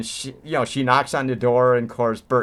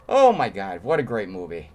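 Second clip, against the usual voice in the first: usual speaking voice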